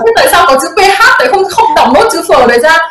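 a stubborn father arguing the spelling; dad's challenge. Retold or imitated. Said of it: "Bố em bảo là".

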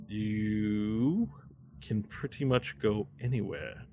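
drawn-out, affected voice; put-on character voice. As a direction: stretch the first word out in an odd put-on voice. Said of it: "why am I doing that voice".

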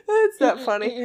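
high breathless laughter; laughing. Real, not acted. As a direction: laugh until it hurts. Laughing fit.

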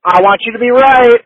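loud, high-pitched burst of hope; excited shout. Real, not acted. Fan answers co-host's bold prediction, excited shout.

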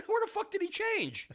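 very high-pitched retold disbelief; incredulous question. Retelling reaction to the thief's new clothes, incredulous. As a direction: squeal the question in bafflement.

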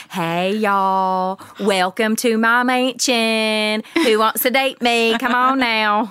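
slow, high, hammed-up hillbilly drawl; thick Southern country twang, played up big; hillbilly accent. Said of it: "I sound like a hillbilly".

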